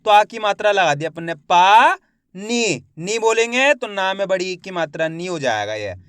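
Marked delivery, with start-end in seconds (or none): 1.3-1.9 पा stretched loud with a long aa; drawn-out vowel. Shown as a demonstration. Teacher showing the long vowel in पा, drawn out. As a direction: pull पा out, rising at the end. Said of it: "पा का उच्चारण खींच को हो रहा है".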